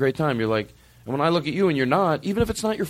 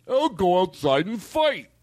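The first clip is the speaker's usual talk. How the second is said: mock tough guy; 4 semitones higher, slower than usual. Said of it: "It's a verbal argument".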